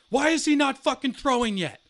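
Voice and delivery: high, loud furious yell; angry coach yell. Retold or imitated. Angry coach yelling from dugout.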